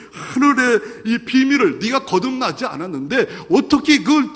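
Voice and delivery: high, loud mocking rebuke; half-laughing scolding; Jesus rebuking. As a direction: scold him for being earthbound.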